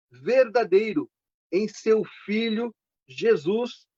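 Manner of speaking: slow, emphatic Bible reading with weighty pauses; solemn scripture reading. Acted. Preacher reading John's letter to the congregation, slow and emphatic. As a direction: read it out deliberately, phrase by phrase.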